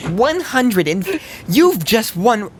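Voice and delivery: high, slow, hammed-up prize announcer; scammy ad voice. Spoofing the friend's blackmail line as a scam ad, high and slow. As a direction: spoof a pop-up ad winner message. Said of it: "the way you said that".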